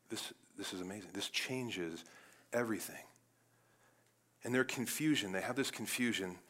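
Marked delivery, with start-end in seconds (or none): 0.1-3.0 very soft, low-key awe; hushed amazement. Acted. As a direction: marvel quietly, almost to yourself. Disciples awed at risen Jesus eating.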